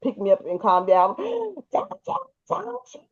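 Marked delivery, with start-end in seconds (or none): 1.2-3.0 high, soft, gasping re-enactment; mock breathless protest, broken up by gasps; out of breath. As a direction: act out trying to holler without air. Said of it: "I wasn't getting enough oxygen back in my lungs".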